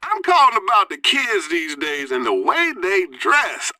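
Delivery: ordinary talk, nothing marked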